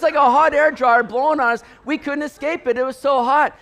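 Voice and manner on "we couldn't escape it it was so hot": usual speaking voice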